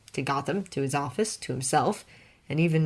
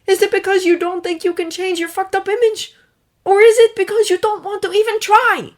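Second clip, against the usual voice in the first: higher, louder cutting challenge; cruel Clark; 10 dB louder than usual. A narrator's reading. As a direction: twist the knife. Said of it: "His tone was cruel".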